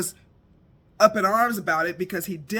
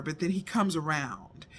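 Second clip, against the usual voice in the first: ordinary delivery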